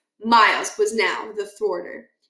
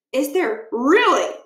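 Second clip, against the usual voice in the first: suspicious; 4 semitones higher than usual. Acted. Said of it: "getting purplish".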